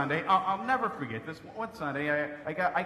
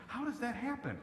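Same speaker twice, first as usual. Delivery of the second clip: lightly voiced woman, higher and softer; curious woman; 7 semitones higher, 6 dB quieter than usual. Woman asks the dad about all boys, higher mock voice. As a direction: imitate a puzzled woman asking, higher pitch. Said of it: "had a woman ask me that".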